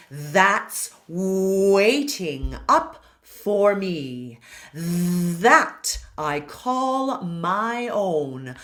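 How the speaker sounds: very slow, overenunciated reading of the lyric; overpronounced. Shown as a demonstration. Coach reads the text slowly so students see vowel shapes, overpronounced. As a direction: stretch every vowel and consonant. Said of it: "I will overpronounce".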